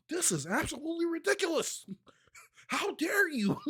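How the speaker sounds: high, prim mock outrage. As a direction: squeak out offended indignation like an angry internet commenter. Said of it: "they make themselves matter when they be going off".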